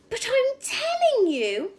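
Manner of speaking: high, loud, slow shout; shouted protest. Acted. Matilda shouts back at the headmistress, refusing to sit. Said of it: "Matilda shouted".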